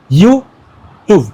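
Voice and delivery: "YouTube" said as two syllables set apart; split into syllables. Shown as a demonstration. Teacher showing students where the word breaks, syllable by syllable. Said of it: "মানে কেমনি হবে এটা?".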